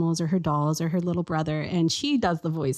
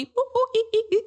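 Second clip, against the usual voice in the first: high-pitched monkey hoots and squeals; monkey noises; faster than usual. Shown as a demonstration. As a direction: imitate a monkey, ooh-ooh-ee-ee. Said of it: "Now I have to sound like a monkey".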